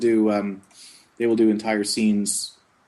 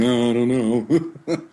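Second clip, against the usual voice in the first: doubtful laugh; 2 semitones higher, 5 dB louder, much slower than usual. Retold or imitated. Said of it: "you walk away going".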